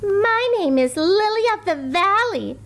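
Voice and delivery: put-on high, proud girl voice; proud schoolgirl. A narrator's reading. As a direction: announce the flower name grandly. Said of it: "said Victoria".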